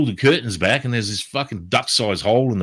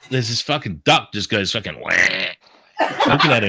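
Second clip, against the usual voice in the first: ordinary talk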